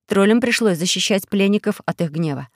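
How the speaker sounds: ordinary delivery, nothing marked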